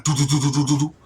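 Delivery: rapid knocking sounds; quick vocal door knocks; imitated door knock. Mimicking a knock at his door mid-story.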